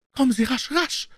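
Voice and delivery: high, breathless urgent woman. Acted. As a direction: gasp it out, hurried. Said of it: "rief sie atemlos".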